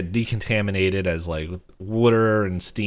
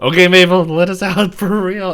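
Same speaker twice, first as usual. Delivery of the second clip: shouted, higher and much louder; panicked shout; 9 semitones higher, 10 dB louder than usual. Retold or imitated. Dipper trapped and banging on the door, shouting for Mabel. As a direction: yell through the door to be let out.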